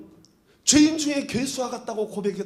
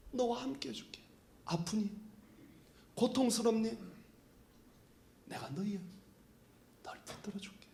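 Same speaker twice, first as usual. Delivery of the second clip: much softer, whispered comfort; tender whisper; 12 dB quieter than usual. Retold or imitated. God's tender promise, hushed. (위로하며 속삭이는 하나님의 목소리)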